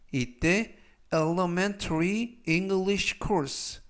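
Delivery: normal talking voice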